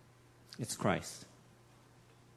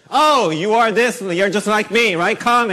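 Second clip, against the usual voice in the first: much higher, much louder, slow gushing greeter; gushing welcome; 9 semitones higher, 11 dB louder than usual. Retold or imitated. Preacher voicing a Southern church greeting newcomers, gushing and loud. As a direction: beam and wave them in like a delighted host.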